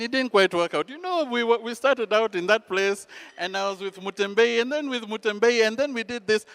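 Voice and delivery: high, lilting reminiscence running on and on; acted long-winded old man; rambling grandfather. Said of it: "you're telling me way too many things".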